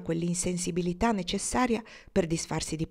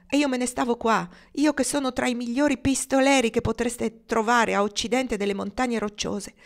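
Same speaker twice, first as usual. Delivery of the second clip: raised, higher voice, bristling with anger; indignant outburst; 5 semitones higher, 5 dB louder than usual. Acted. A gunslinger venting his fury to the woman he loves, indignant. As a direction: let his pride and anger burst out.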